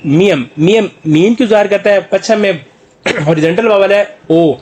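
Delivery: ordinary talk, nothing marked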